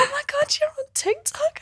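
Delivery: put-on excited little girl. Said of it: "she was like".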